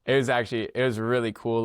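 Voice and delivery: normal talking voice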